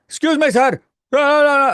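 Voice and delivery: loud, high-pitched obnoxious yell; mock yelling customer. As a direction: imitate a rude customer yelling across the room. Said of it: "he's, like, yelling at me".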